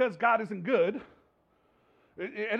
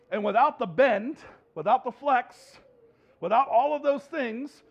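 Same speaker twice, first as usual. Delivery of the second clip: preaching louder; 3 semitones higher, 7 dB louder than usual. Real, not acted. Preacher building to a point.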